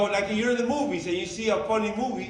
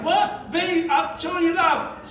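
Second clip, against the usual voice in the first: high, pitched-up mock protest; mock hurt surprise; 8 semitones higher, slower than usual. Husband protesting to wife over a hug, high mock surprise. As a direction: act baffled and wounded. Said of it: "I'm like".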